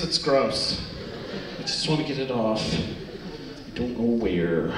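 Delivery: low, dragged-out mock dismay; hammed-up low whine of helplessness; helpless messy kid. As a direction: play a grossed-out child, trailing into slow low words. Acts kid stuck with messy hands.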